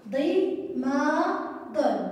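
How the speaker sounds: ضي، ما، دن drawn out one by one, very slow; slow syllables. Shown as a demonstration. Teacher reading syllables slowly to young pupils. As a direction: spell it out slowly, beat by beat. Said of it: "نعم يا أبطال الصغار".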